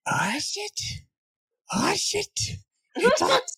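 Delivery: high, far bug voice; startled insect voice. Acted. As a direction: squeak it out like a panicked bug.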